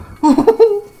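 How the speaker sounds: a high burst of excited laughter. Real, not acted. Gamer celebrating a hit, high laughing burst. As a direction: cackle at the win.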